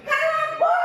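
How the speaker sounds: shouted very high and loud; yelled. Retold or imitated. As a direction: bellow it to shut her up. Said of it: "E eu falei muito alto. / Eu berrei muito". (grito muito alto e agudo)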